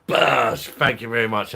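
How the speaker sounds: loud, high catchphrase holler; celebratory shout. Real, not acted. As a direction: shout it out, then keep the thanks loud. Catchphrase shout for a super chat.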